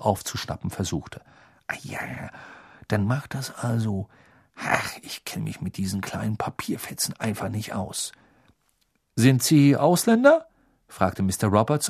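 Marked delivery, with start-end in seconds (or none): 1.7-8.1 soft whispered muttering; hushed muttering. A narrator's reading. Arthur whispers, embarrassed. 9.2-10.5 suspicious question. A narrator's reading. Mr Roberts asks suspiciously.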